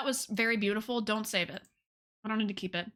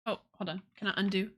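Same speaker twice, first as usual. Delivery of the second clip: ordinary delivery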